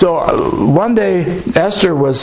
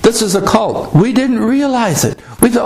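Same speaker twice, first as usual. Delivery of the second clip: ordinary talk, nothing marked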